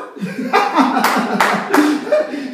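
loud, high-pitched laughter; hearty laugh. Real, not acted. Pastor tickled by the church mother's confession, loud laughter. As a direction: burst out laughing, really tickled.

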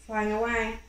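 ordinary delivery

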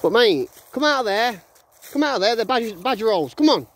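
high call out to the dog. Real, not acted. Dog owner calling dog from badger hole, high call.

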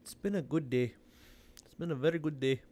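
put-on East Indian accent. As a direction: do a playful, well-practised Indian accent. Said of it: "I don't know why I'm talking like this".

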